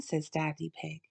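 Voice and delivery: plain reading voice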